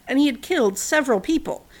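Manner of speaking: plain reading voice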